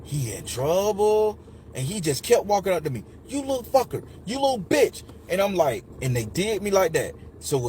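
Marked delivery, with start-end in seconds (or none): 0.0-1.4 long drawn-out, sing-song ooh; classmates' teasing. Retold or imitated. Classmates teasing him in chorus. 3.3-5.0 high-pitched imitation of an abuser; mock angry insult. Retelling an attacker's insults.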